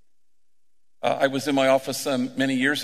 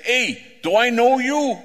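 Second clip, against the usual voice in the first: slow, booming and much higher; loud voice; 10 semitones higher, 6 dB louder, slower than usual. Retold or imitated. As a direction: call out loud and slow to a stranger. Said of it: "loud-ass voice".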